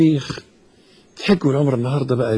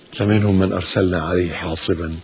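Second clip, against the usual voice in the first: usual speaking voice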